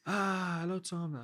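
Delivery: high-pitched greeting of an important caller; imitated effusive greeting. Michelin's president appearing on the chef's screen, effusive imitated greeting.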